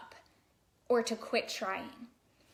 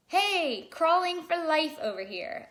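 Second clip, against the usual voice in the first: higher and louder, cocky call-out; proud baby; 6 semitones higher, 5 dB louder than usual. Pretend. Baby boasting about crawling to friends, loud and high. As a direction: brag like a kid who has found his thing. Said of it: "if babies had this conversation".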